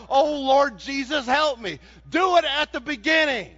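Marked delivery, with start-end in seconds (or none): 0.1-1.8 mock desperate yell. Shown as a demonstration. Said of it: "not the best time to yell".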